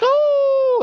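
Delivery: high, loud mock howl; imitated howl. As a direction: imitate a crazed, drawn-out "oh" howl. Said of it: "they start just howling".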